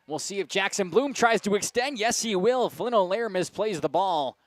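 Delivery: high, loud, rolling commentary; excited play-by-play. Acted. Announcer calls a bloop single and error, excited play-by-play. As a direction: raise the call as the play unfolds, easing at the end.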